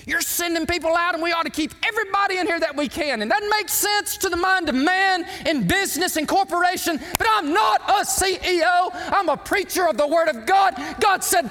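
high, loud pulpit preaching; preaching at full force. Acted. Pastor preaching to his church, high and loud. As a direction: drive it home like a revival preacher.

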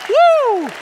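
loud high celebratory whoop; joyful whoop. Real, not acted. Preacher whooping over Jesus' return, loud and high. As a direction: cheer like a revival preacher.

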